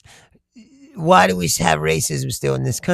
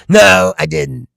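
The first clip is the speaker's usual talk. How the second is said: ordinary delivery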